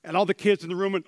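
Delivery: ordinary talk, nothing marked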